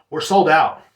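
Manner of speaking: mock yell into the phone; retold yell. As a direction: raise your voice at a caller who won't hear. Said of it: "And then I yelled at her".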